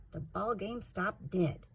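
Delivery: ordinary delivery, nothing marked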